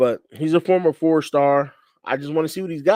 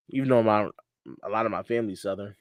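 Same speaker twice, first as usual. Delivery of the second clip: normal talking voice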